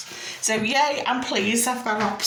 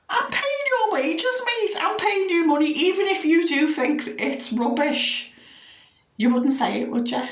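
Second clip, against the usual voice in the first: indignant retort; 8 semitones higher than usual. Retold or imitated. Retorting to a rude plumber.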